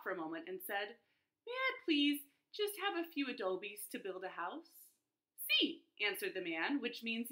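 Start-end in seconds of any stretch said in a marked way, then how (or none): 1.5-4.9 high, sweet asking; small polite voice. A narrator's reading. Third javelina asks politely. 5.4-5.8 high, loud cheerful sí; bright Spanish sí. A narrator's reading. Brickmaker answers yes.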